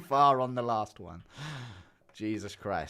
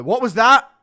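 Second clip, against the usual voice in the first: high, loud parent calling up the stairs; 9 semitones higher, 13 dB louder than usual. Retold or imitated. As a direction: call out like a suspicious parent. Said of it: "you hear them go".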